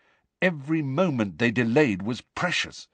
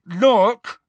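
louder, higher clipped warning; barely contained anger; 13 semitones higher, 7 dB louder than usual. A narrator's reading. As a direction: hold the anger down, just. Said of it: "barely containing his anger".

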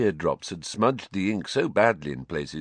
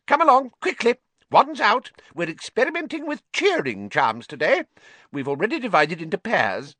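very high, loud, squeaky urging; a small excitable wizard hurrying boys in; brisk tiny professor; 13 semitones higher, 3 dB louder than usual. Acted. Flitwick voiced, brisk.

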